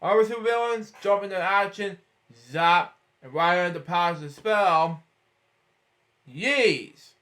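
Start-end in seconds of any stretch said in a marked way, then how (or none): none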